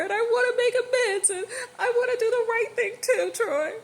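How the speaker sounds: a furious wife near breaking, pleading and yelling at once; livid crying shout. Acted. Wife shouting at husband over the baby, livid and tearful. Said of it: "Tasha was livid".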